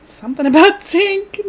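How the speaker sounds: high, loud and stretched out; dramatic drawn-out. Shown as a demonstration. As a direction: belt it like a band name. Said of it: "name of my new band".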